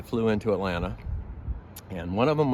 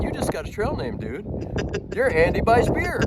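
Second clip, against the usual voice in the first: teasing announcement; 11 semitones higher than usual. Retold or imitated. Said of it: "And I said".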